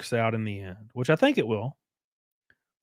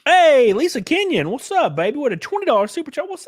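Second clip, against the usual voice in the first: hyped greeting; 14 semitones higher, 4 dB louder than usual. Real, not acted. Streamer greets a twenty dollar super chat donor, hyped. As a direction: hail the donor like a big star.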